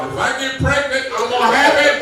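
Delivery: high, loud put-on voice; hammed-up high voice declaring it; mock high-pitched vow. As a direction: scare them off with a shrill-sounding vow. Threatening attackers in jail.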